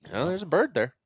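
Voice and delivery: high, insistent mock dad voice; imitating dad. Son mimics his dad insisting a bird is there, high and insistent. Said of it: "my dad's like".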